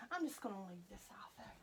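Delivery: a quick hushed note to yourself; whispered aside. Real, not acted. Murmuring to herself while dressing the doll, whispered. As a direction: whisper it under your breath to yourself.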